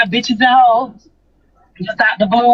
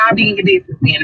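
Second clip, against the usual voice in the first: mock challenge; 10 semitones higher, much faster than usual. Retorting to Manny in her retold quote.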